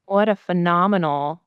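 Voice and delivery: ordinary delivery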